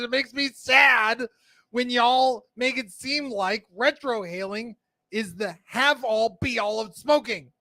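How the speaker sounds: high, slow, whiny lament. Acted. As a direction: whine it out, self-pitying and slow. Reads viewer comment in whiny voice.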